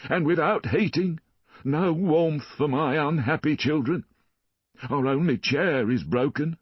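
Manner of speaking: high, fawning beggar's voice; a pleading poor father, pitched well up; pleading Jondrette. A narrator's reading.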